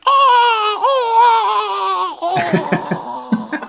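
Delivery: high, loud drawn-out wail; mock cartoon villain scream. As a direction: scream a long "oh", sinking as it goes. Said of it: "He's like".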